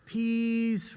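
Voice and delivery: high "Peace" for please, with the L missing; gap-toothed toddler. Retold or imitated. Toddler asking mom for a cookie, gap-toothed "Peace". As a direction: say "Peace" like a small child, drawn out and high. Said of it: "gap in her teeth".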